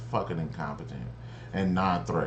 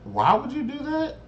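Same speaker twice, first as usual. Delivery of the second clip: high, disbelieving cry; incredulous. Retold or imitated. Viewer reacting to the kid yelling narc, high incredulous cry. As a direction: squeak out the disbelief. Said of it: "And I was like".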